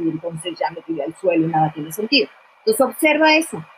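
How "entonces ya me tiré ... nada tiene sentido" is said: a lower, deflated, mock-sad voice; mildly acted despair; acting out sadness. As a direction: play the slumped, hopeless reaction, lightly. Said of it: "reacciono súper triste".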